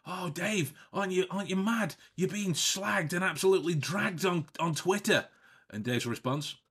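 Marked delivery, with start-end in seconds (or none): 0.1-5.2 high, agitated imitation of a concerned asker; animated mock indignation; outraged questioner. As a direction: retell someone's alarmed question, pitched up. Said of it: "And someone was like".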